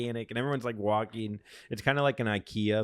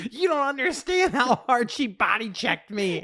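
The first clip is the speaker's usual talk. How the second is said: much higher, louder whiny complaint; mock her indignant protest; imitating Brenna; 11 semitones higher, 4 dB louder than usual. Boyfriend mimicking girlfriend's complaint about the old lady, high and whiny. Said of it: "Brenna goes".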